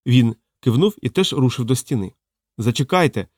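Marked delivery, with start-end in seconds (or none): none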